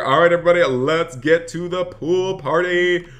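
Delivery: high, hyped-up rallying call; rally everyone, stretching out the words; excited announcement. Real, not acted. Streamer leading viewers to the pool party, hyped.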